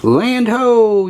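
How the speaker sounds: high, ringing storybook call; lookout's yell. A narrator's reading. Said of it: "yells First Mate Kelly".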